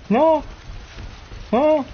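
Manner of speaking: high-pitched squeaked no; squeaky no. Real, not acted. Gamer at a dead end, squeaked high no. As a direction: squeak out a disbelieving no.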